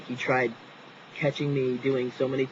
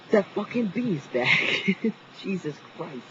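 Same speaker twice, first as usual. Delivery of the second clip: high, drawn-out dismay with a nervous laugh; real startled alarm; 7 semitones higher, slower than usual. Real, not acted. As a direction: squeal in dismay as the bee returns. Bee returns, interrupting the story.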